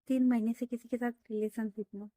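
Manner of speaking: nervous rapid confession; rushed fast. Acted. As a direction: blurt it all out fast in one breath. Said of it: "इतना fast बोलती". (एक साँस में बहुत तेज़ी से बोलना)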